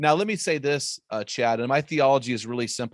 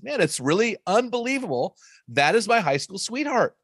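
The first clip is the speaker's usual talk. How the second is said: high, bright retelling of an excited wife; delighted wife; 7 semitones higher than usual. Retold or imitated. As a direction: lift it up like she can't believe her luck. Wife's delighted reply in retold story.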